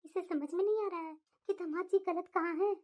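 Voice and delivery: low, calm mother voice; mother calming her angry daughter, low and gentle; soothing mother. Acted. Mother lamenting.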